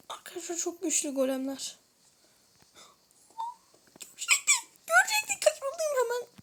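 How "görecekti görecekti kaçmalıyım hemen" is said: very high alarmed cry; panicked squeal. Real, not acted. Nearly spotted by golem. (panik içinde)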